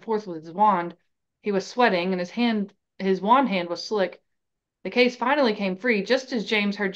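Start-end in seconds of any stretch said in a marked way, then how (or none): none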